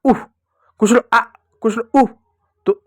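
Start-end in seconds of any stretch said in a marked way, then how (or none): 0.1-0.3 उह as a short "uh" of pain; brief demonstrated ouch on उह; hurt sound. Shown as a demonstration. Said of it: "चोट लगा बोलते हैं ना". 1.9-2.1 short उह, like a small ouch; उह in one brief puff; hurt sound. Shown as a demonstration. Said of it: "चोट लगा बोलते हैं ना".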